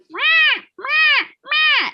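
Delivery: very high, loud mock meow like a cockatoo; high parrot meow; imitating a bird meowing. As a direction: squawk out a cat's meow as the parrot would.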